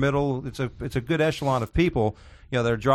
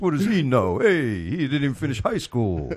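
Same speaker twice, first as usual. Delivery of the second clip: Dangerfield impression. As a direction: keep the stand-up bit going. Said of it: "Are you doing Dangerfield right now?".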